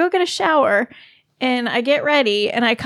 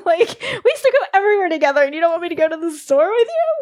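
rising, faster, hammed-up despair; mock lament; 7 semitones higher than usual. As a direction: overplay the heartbreak, climbing higher. Wife mock-laments husband's coldness.

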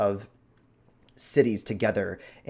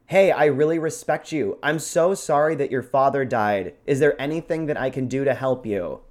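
loud, raised-pitch condolences; always loud; 5 semitones higher, 6 dB louder than usual. Shown as a demonstration. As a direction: offer sympathy at an oblivious shout. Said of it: "Maybe they're just always loud".

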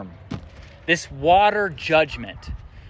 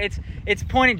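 ordinary talk

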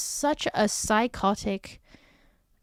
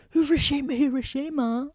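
mock yelling; 6 semitones higher than usual. Vlogger yelling at subway passengers, half-voiced mock yell. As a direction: imitate someone calling out on a train. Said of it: "you're just yelling, like".

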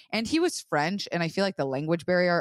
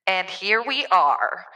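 slow, modified voice through an app; slowed voice filter; 4 dB louder, much slower than usual. Real, not acted. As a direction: stretch it out like a voice changer. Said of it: "voice modifier".

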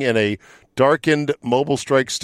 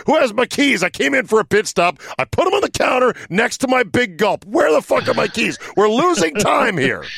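frantic Scott Dixon; 12 semitones higher than usual. Pretend. Podcast host acts out a race driver losing his keys, frantic high yelling. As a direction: shout it like a driver in a panic who lost his keys mid-race. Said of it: "how could Scott Dixon lose his keys?".

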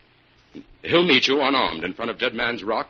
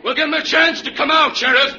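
loud, higher call across the distance; 6 dB louder than usual. Acted. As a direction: project it over the water. Calling across to sheriff.

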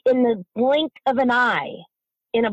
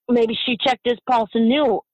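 ordinary talk, nothing marked

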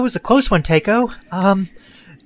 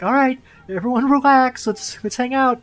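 calming; 7 semitones higher, 6 dB louder than usual. Acted.

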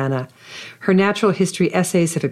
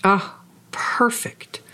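breathy whisper; 5 semitones higher, much slower than usual. Real, not acted. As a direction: breathe it out in awe. Host reacts softly to the answer.

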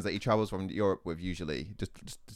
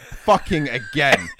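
slow, louder, laughing through it; exasperated laugh at bad luck; laughing disbelief; 4 semitones higher, 9 dB louder, slower than usual. Real, not acted. Telling a friend about another bloody bed, laughing exasperation.

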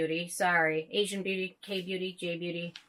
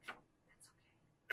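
whispered; 23 dB quieter, much slower than usual. Real, not acted. Embarrassed aside after calling self dork.

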